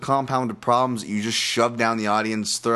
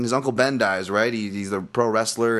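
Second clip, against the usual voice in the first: usual speaking voice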